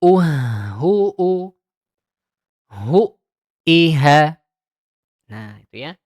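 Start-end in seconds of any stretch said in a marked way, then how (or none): 0.0-4.4 louder syllables with a glottal catch between; doubled hamzah drill. Shown as a demonstration. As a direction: clip each vowel with a throat stop. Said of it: "ini juga sama".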